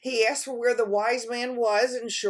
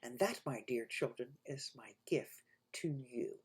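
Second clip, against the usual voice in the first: softer, lower story ending; storyteller close; 9 semitones lower, 12 dB quieter than usual. A narrator's reading. Storyteller closing the tale to dear children, soft. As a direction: tell the little ones gently.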